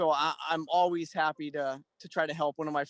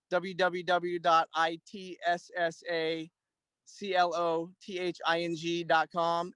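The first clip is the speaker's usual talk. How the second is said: spelling out a web address. Real, not acted. Spells out website letter by letter.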